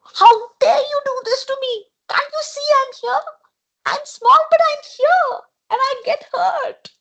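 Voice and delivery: high, indignant little frog voice; a small creature shouting to be noticed; squeaky frog scolding the animals; angry tiny frog. A narrator's reading. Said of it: "Tiddalik got so angry".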